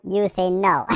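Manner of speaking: usual speaking voice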